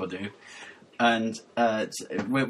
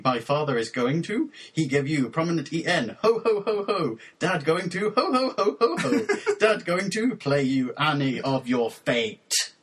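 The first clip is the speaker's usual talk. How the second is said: raised, deliberate reading with questioning lifts; deadpan lyric recitation; 4 semitones higher than usual. Acted. Host reading mangled pop lyrics for co-host to guess, raised deliberate recitation. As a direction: recite it solemnly, phrase by stilted phrase.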